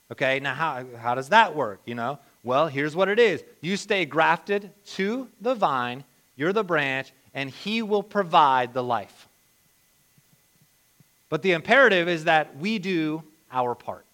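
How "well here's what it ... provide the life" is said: ordinary talk, nothing marked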